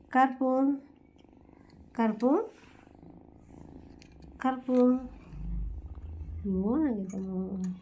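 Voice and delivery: hushed cooing to a tiny kitten; soft pet call. Real, not acted. Owner softly calling tiny Karpu, hushed cooing.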